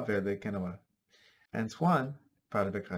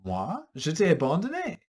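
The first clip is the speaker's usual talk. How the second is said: character voice; 6 semitones higher, 5 dB louder than usual. Acted. Character voice in French story.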